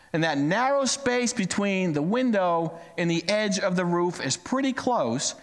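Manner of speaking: usual speaking voice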